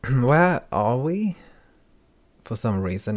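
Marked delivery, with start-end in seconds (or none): 0.1-1.4 higher, louder, slow cheerful question; oddly bright, drawn-out ask; bizarrely cheerful. Acted. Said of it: "a tone that seemed bizarrely cheerful".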